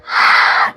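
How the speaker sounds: voiceless breath let out; breathy exhale sigh. Shown as a demonstration.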